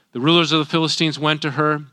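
normal talking voice

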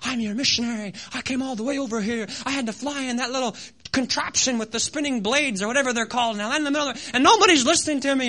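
retold griping at God, rising in pitch and volume; angry complaint. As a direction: build from protest to loud mock outrage. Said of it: "gotten angry and I'm like".

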